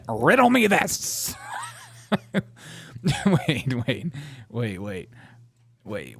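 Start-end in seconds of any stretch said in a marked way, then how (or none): 0.1-1.4 slow, higher, hammed-up Riddler voice. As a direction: play the villain asking a riddle.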